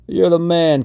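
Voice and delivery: high, loud call to a buddy. A narrator's reading. Count to trainee climbing into the truck, calling out.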